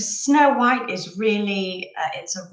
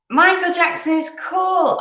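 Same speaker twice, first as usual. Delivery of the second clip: much higher and louder, gushing; excited; 10 semitones higher, 5 dB louder than usual. Real, not acted. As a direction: read the comment out like a thrilled fan. Reading a viewer's comment out.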